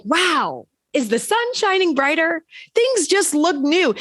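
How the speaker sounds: high, bright-eyed wonder; amazed wonder. Retold or imitated. After lunch with family, amazed high wonder.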